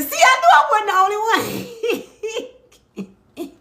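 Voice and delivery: high, loud, fast squeal breaking into laughter; laughing squeal. Real, not acted. Vlogger finding someone else who did prank accents, laughing squeal. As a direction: squeal it out, cracking up. Delighted squeal at viewer's shared confession.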